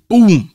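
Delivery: loud high boom; gunshot noise. Retold or imitated. Imitating the shotgun blast, loud. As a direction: shout out the gunshot sound. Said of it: "So I just gone".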